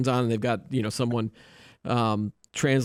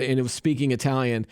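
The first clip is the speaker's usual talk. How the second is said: usual speaking voice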